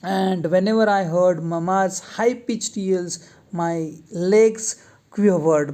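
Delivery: reads the English line word by word, very slowly; slow read-aloud. Shown as a demonstration. Teacher reading the lesson text aloud to students, slow and deliberate. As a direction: dictation pace for students.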